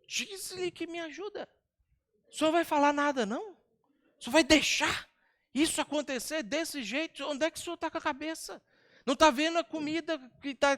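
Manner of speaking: sharp overworked woman venting at her guest; exasperated Marta. Acted. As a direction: scold Jesus like a frazzled hostess who's had enough. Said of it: "pensa que tom de voz deve ter sido feita essa pergunta".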